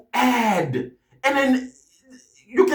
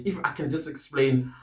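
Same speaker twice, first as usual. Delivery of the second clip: ordinary talk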